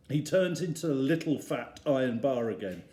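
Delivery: usual speaking voice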